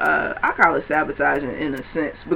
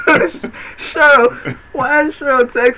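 laughing; 7 semitones higher, much slower than usual. Real, not acted. Laughing while imitating.

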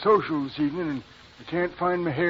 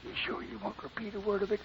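hushed conspiratorial whisper; 7 dB quieter than usual. Acted. Neighbor whispering gossip to the couple, hushed. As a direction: lean in and whisper the secret. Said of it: "Hey, what are we whispering for?".

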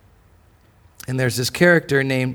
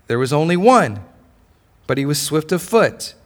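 ordinary delivery

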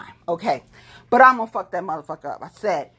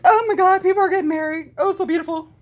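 gushing mockery; 11 semitones higher, 5 dB louder, faster than usual.

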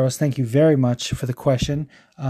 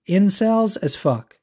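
higher, slow, emphatic disgust; worked up; 6 semitones higher, slower than usual. Real, not acted. Host branding sexist fans, angry. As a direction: drive the insult home, drawn out. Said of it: "while I calm down".

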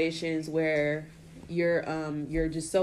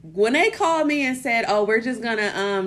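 excited; 4 semitones higher, 6 dB louder than usual. Retold or imitated. Excited retelling of being fired.

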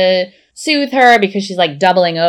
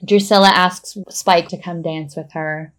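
usual speaking voice

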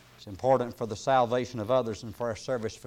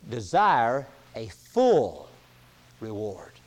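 slow dwelt-on preaching; 2 semitones lower, 3 dB louder, much slower than usual. Acted. Preacher dwelling on full.

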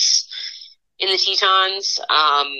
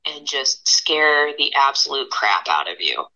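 ordinary talk, nothing marked

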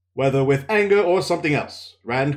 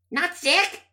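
much higher, sharp disbelieving woman; Nynaeve's voice. Acted. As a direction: throw the question back in scorn. Nynaeve's raised voice.

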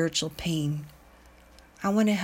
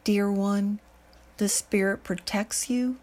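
ordinary delivery

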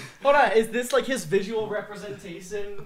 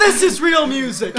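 high, loud mock pride; mock teacher; 10 semitones higher, 13 dB louder than usual. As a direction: announce it as a hammed-up snob. Friend voices teacher.

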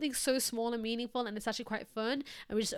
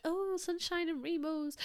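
mock cringe; 6 semitones higher, slower than usual. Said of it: "That sounds so cringe".

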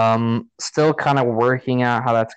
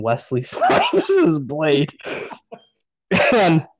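laughing; 5 semitones higher, much slower than usual. Real, not acted. Laughing at own absurd casting.

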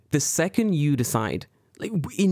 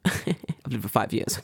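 usual speaking voice

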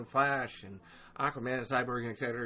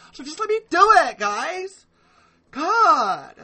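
much higher, louder, fast exasperated cry; exasperated outburst; 14 dB louder, slower than usual. Real, not acted. Podcaster begging critics to let him talk, high loud exasperated cry. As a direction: throw up your hands and let it burst out.

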